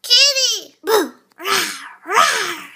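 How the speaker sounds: put-on high, loud toddler voice; Boo impression. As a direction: shout it like a delighted toddler. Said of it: "Boo impression".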